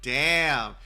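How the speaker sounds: loud burst of annoyance at a slip; real frustrated curse. Real, not acted. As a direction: swear out loud at your own mistake. Reader flubs line, curses.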